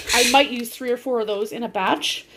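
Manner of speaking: ordinary talk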